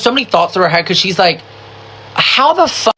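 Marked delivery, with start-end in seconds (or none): 2.1-2.9 high-pitched baffled outburst; incredulous retold reaction; mock disbelief. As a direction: voice her bewildered thought, pitched way up. Acts out her stunned thought.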